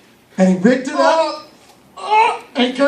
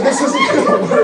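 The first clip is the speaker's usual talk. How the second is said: put-on high, laughing boy's voice; high David voice. David giving up on the heavy armor, high and laughing. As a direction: squeak it out like young David.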